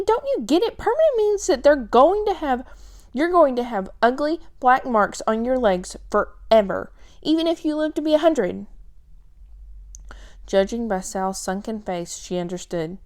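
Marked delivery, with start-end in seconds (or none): 0.0-8.6 high, loud, frustrated pleading; exasperated. Acted. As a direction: drive the point home, upset.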